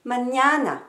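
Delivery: Mañana with a long, strong "ña"; Mañana as ma-ÑAA-na, falling at the end; stress on second syllable. Shown as a demonstration. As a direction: lean hard on the middle of Mañana. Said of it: "l'accent sera toujours sur la deuxième syllabe".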